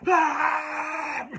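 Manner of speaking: a high, loud "Ah!" cried out in pain; a loud, high scream of pain on "Ah!" with a laugh in it; full pained scream. Retold or imitated. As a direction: shriek "Ah!" as if the wound was just pressed. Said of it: "I didn't scream like "Ah!" I was like".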